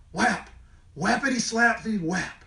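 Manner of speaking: ordinary delivery, nothing marked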